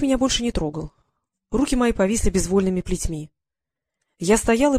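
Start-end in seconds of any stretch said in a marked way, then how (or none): none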